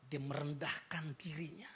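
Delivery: a hushed, humble whisper. Acted. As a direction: breathe it out, barely voiced. Preacher whispers key line.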